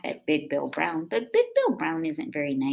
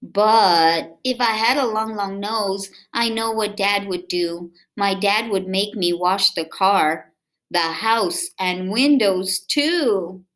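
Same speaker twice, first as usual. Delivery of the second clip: lively, sing-song read-aloud voice; reading to children; 4 semitones higher, 7 dB louder than usual. A narrator's reading. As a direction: read it to little kids with bounce. Reading picture book aloud.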